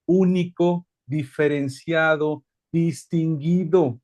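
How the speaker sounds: único, diferenciado, distinguido said slowly, word by word; savoring each word. Shown as a demonstration. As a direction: draw out único, diferenciado and distinguido one at a time. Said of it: "escucha esto". (único, diferenciado y distinguido, despacio y marcados)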